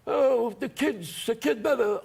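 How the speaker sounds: high, drawn-out wiseguy voice; armed gangster. Retold or imitated. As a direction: play a slow mobster in the audience. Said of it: "they all had the little sling guns".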